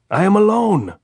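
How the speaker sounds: high, loud answer across the wire; shouting. A narrator's reading. Andres answering the sentry's challenge, shouting. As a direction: yell it up so they hear. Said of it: "Andres shouted".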